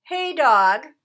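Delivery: loud call across the yard. A narrator's reading. Rat calling to dog across the junkyard, loud. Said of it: "Rat called".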